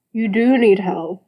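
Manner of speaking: mumbling through toothpaste; mouth full. Acted. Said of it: "he mumbled, mouth full".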